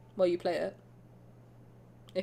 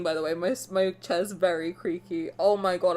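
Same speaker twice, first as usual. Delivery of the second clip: usual speaking voice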